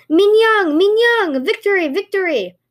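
shouted triumphant cry; victory cheer. Acted. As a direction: roar it like a cheering crowd. Villagers roar cry of victory.

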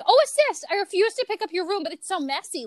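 high-pitched TikTok mom impression; exaggerated high voice of an unhelpful mom; mock refusing mom. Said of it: "your mom is helping you clean your room".

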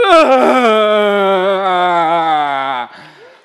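loud, high, wailing mock sob; ugly Oprah cry. Pastor acts out the ugly cry for the crowd, loud hammed-up wail. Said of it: "it's that ugly Oprah cry".

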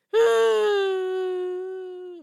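long high wail, fading out; frustrated wail. Real, not acted. Podcaster despairing over failed takes, high frustrated wail. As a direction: let out a loud drawn-out whine.

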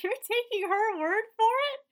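high, loud, outraged disbelief; incredulous. Acted. Viewer talking back to the character, incredulous. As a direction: pitch leaps up in an incredulous question.

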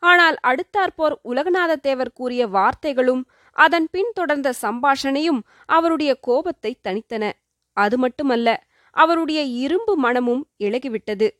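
plain reading voice

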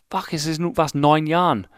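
normal talking voice

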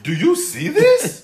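put-on high, slow, laughing kid voice; a small boy daring someone to look; indignant kid. Little brother's glare, acted out.

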